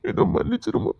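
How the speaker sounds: breathless whisper, almost no voice; whispered plea. Acted. Father whispers to daughter for forgiveness, pleading. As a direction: beg forgiveness under the breath.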